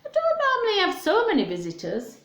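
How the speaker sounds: high, fast puzzled bear voice. Acted. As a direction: rattle it off, baffled. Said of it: "said Bear".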